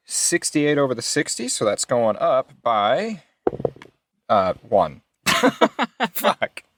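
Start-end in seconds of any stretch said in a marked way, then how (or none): none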